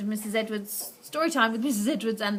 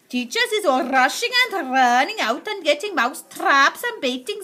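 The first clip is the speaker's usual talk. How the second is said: gleeful witch relishing the havoc, louder; gloating witch prophecy; Grand High Witch voice; 4 semitones higher, 6 dB louder than usual. Acted. Witch describes the havoc to the crowd, gloating.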